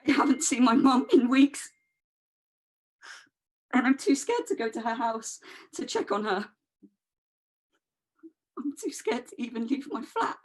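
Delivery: high-pitched, breaking into tears; too scared, crying as you confess it; tearful fear. Acted. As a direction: voice climbs high, fading into frightened crying. Frightened narrator breaking down at end.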